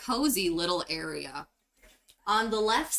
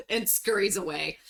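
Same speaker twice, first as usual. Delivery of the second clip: usual speaking voice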